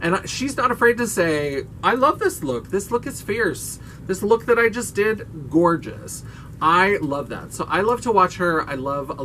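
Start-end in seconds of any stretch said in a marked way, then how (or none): 1.8-6.2 high-pitched, gushing declaration; confident cheerleader for your own work; proud self-praise. Retold or imitated. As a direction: hype yourself up unashamedly. Acting out a YouTuber's confident self-praise.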